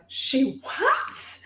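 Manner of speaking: breathless whispered she what; whispered disbelief. Retold or imitated. Learning a big account shared her video, whispered in shock. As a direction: whisper it, stunned.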